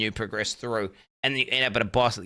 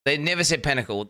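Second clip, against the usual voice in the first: normal talking voice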